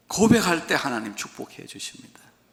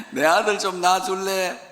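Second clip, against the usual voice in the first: laughing Gyeongsang proposal; 6 semitones higher, 4 dB louder, slower than usual. Retold or imitated. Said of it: "경상도 사람들은 반지를 주면서 그래요".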